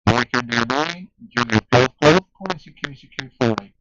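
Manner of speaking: calling out loudly over the air; loud radio call. Real, not acted. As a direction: project the call hard.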